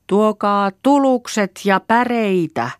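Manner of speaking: high, drawn-out call for help; first try at hollering home. A narrator's reading. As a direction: shout it slowly across the woods. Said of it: "ruvetaan huutamaan".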